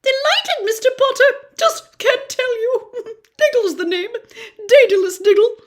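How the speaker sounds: very high and loud, bursting with glee, a laugh breaking in; overjoyed little wizard. Acted. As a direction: squeal with delight at meeting a hero. Diggle overjoyed to meet Harry.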